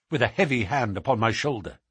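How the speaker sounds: ordinary delivery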